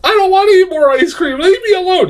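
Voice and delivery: put-on very high, pleading old lady voice. Reviewer voicing the ad's frightened old lady, high pleading. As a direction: squeak it out like a frail old woman. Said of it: "and she's like".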